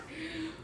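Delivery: breathless laughing near tears; quiet teary giggle. Real, not acted. Vlogger overcome by her new laptop, teary laugh.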